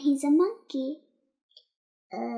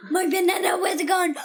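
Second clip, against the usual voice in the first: mock crying monkey; much faster than usual. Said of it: "I think she still sounds like this".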